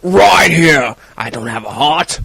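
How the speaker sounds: low, soft, slow British tough-guy mumble; gruff action hero impression. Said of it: "I think I just got a new impression".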